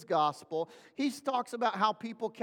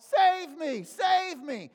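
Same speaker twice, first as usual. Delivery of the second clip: high, louder mock plea; crying out; 7 semitones higher, 3 dB louder, much slower than usual. Preacher asks congregation about a cry, crying out. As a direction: shout it like someone needing rescue. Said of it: "might somebody cry out".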